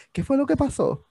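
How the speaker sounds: mocking a TV host's question; mock calm question. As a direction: parrot the question in a high teasing voice. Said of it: "estaba así como que en su temple, normal".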